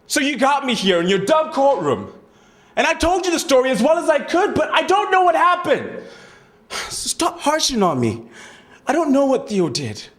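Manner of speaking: very high, loud, overwrought pleading; upset protest. Acted. Pressured witness to the courtroom, distraught outburst. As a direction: protest desperately at the top of your range.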